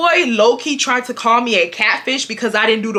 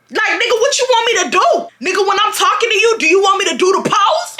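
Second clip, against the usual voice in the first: hyped shout; 9 semitones higher than usual. Real, not acted. Reliving the car argument for viewers, hyped shouting. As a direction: blow up at him in outrage. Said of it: "I'm sorry if I'm so hyped".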